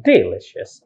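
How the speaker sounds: "delicious" as DE-licious; mis-stressed "delicious", weight on DE; wrong first-syllable stress. Shown as a demonstration. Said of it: "Not the first, like".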